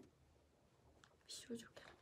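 barely voiced, shy little aside; sheepish whisper. Real, not acted. Idol whispering her own pretty-girl label to viewers, embarrassed.

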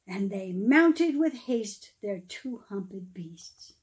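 lively storybook read-aloud, rising and falling; storyteller reading verse aloud; reading to children. Acted. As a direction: read the Christmas rhyme to a room of kids. Reads Christmas poem to children.

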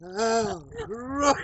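high shouted gasps from the cold; in ice cold water. Real, not acted. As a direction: gasp through ice cold shock. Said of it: "a lot colder than I thought".

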